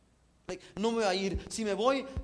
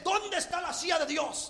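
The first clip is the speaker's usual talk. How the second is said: higher, demanding cry of an angry Job; indignant demand; 9 semitones higher than usual. Acted. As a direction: Job storming at God, slow and raised. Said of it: "Está enojado".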